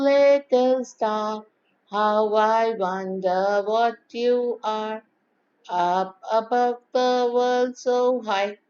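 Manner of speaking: slow, level, unmodulated recitation; plain nursery rhyme. Shown as a demonstration. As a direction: recite slowly, holding each line on an even tone. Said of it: "taking a plain and simple nursery rhyme".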